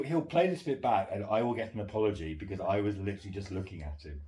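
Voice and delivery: ordinary delivery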